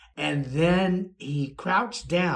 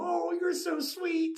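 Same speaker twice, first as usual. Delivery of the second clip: high, soft cooing imitation; retold touched old lady; 13 semitones higher, 6 dB quieter than usual. Old lady answers his compliment, high soft mock coo. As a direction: coo it high, mimicking her.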